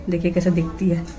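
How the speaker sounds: ordinary talk, nothing marked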